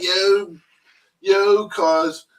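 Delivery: slow and drawn out, Northeast Philly style; Northeast Philly accent. Retold or imitated. Friend imitating how Northeast Philly guys talk. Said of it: "Then you got your Northeast accent".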